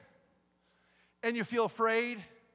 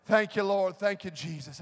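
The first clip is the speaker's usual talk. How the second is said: ordinary talk, nothing marked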